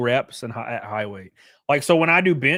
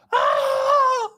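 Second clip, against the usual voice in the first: sudden shrill fake roar, way up high; high mock rage scream; 4 dB louder than usual. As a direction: scream like a roid-raging gym bro, hammed up. Mocking the steroid rage.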